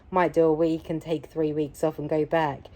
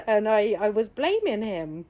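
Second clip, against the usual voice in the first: ordinary talk, nothing marked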